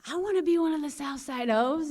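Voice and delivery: high, loud mock-eager wish; joking. Joking with an older cellmate, playful mock-eager call. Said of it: "I used to joke with her".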